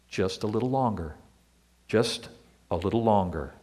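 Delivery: soft and low, patient reassurance; gentle answer. Retold or imitated. Preacher giving God's answer to sufferers, soft and gentle. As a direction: say it softly like a patient father calming a child. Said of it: "the answer is usually".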